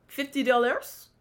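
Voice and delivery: a light mock French accent on "Fifty dollars?"; lightly mocking French accent, rising on "dollars". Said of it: "she said in her French accent".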